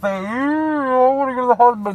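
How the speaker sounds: high, pleading mock voice of the beaten guy; high plaintive imitation of a hurt man; whiny beaten guy. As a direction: whine it like a bloodied inmate begging out. Victim voiced in jail story.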